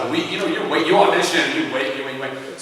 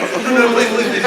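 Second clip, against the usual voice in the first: higher, louder mock begging; urgent retold plea, pitched up and louder; imitated pleading; 8 semitones higher, 6 dB louder than usual. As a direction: imitate an agent begging insistently. Retold agent begging casting people.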